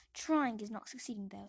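loud cold rebuke; Aunt Petunia. Acted. Aunt correcting the boy, loud and cold. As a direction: cut him down coldly. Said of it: "says Aunt Petunia".

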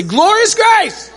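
high, drawn-out rallying yell; loud. Shown as a demonstration. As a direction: yell it out to rouse the crowd. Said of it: "like, loud".

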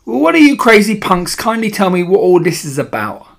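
put-on loud, high indignant child; shout, outraged at everyone; bratty boy demanding an explanation. A narrator's reading. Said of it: "shouted Bruno".

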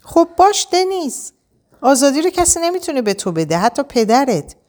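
said out loud. A narrator's reading. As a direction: speak up firmly, pitched high. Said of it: "اینو باید با صدای بلند گفتم".

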